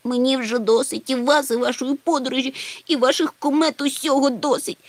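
high tearful wail, voice near breaking; wailing and sobbing. A narrator's reading. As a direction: wail it out like a small scared creature about to cry. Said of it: "заголосив маленький Чмих і розридався". (плаксиво заголосити)

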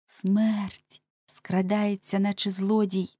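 "Смерть" very slow and drawn out; ominous warning. Acted. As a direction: stretch "Смерть скрадається" into a creeping warning. Ominous warning answered by puzzled question. (зловісно, дуже повільно: «Смерть скрадається»)

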